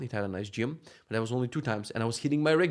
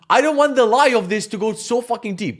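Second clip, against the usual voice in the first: raised, loud exasperation; exasperated protest; 9 semitones higher, 7 dB louder than usual. Retold or imitated. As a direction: push back, fed up and loud. Protesting the wife's scale trick.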